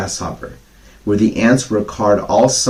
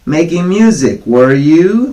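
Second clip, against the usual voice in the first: higher, louder sarcastic jeer; mocking cry; 7 semitones higher, 6 dB louder, slower than usual. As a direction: sneer back at the grasshopper. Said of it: "they cried".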